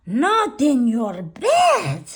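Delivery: high, loud, drawn-out wail; shocked outcry. A narrator's reading. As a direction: cry out in horrified disbelief. Amigos exclaim in alarm.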